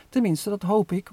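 usual speaking voice

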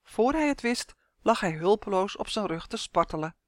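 ordinary delivery

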